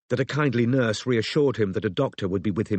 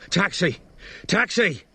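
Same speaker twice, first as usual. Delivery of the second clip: high hailing cry, rising on the repeat; bellowing; 8 semitones higher, slower than usual. A narrator's reading. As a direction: call out urgently for a cab. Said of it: "Strike bellowed".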